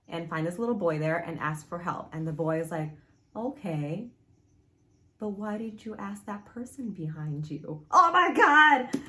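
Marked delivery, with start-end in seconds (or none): 3.4-7.9 hushed, innocent-sounding question with a creepy undertone; quiet, ominous storytelling hush; soft, eerie boy's line. Acted. Boy's creepy line, acted softly. 7.9-9.0 loud, high, drawn-out creeped-out cry; loud spooked reaction; freaked-out exclamation. Real, not acted. Reacting to the scary moment.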